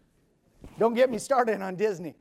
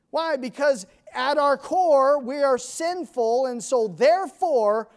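preaching at full force; 6 semitones higher, 7 dB louder than usual. Acted. Preaching on sin.

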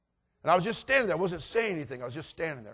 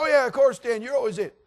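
put-on high, loud kids' voices; hearty agreement; 9 semitones higher, 8 dB louder than usual. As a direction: chime in like boys in the yard. Kids agree loudly with Stan.

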